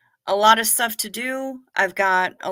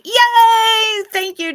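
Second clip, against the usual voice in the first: excited cheer; 12 semitones higher, 10 dB louder, much slower than usual. Real, not acted. Cheering for listener's song.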